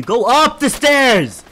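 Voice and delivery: loud, slow, high yell up the stairs; stretched-out angry command; shouted order. Real, not acted. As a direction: bellow it at a teammate who isn't moving. Yelling at teammate during fight.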